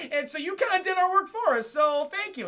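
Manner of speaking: high, loud laughing gloat; gloating villain laugh. Retold or imitated. The villain thanks heroes for doing his work, gloating laugh. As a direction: laugh big, then thank them through it.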